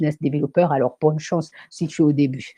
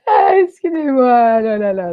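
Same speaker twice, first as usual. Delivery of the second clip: recovering from laughter; 8 semitones higher, 7 dB louder than usual. Real, not acted. Host excuses herself to viewers, winding down from laughter. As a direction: apologise while coming down from a laughing fit.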